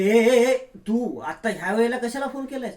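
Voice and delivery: a jolt of panic at the call; startled, scared. Acted. Tanvi startled by the nurse's late call, scared cry. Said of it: "Tanvi नर्सचा आवाज ऐकून घाबरते".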